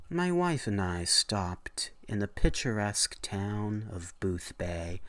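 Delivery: ordinary delivery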